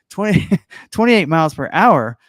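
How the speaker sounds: laughing through a correction. Real, not acted. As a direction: correct yourself while cracking up.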